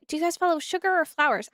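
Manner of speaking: ordinary talk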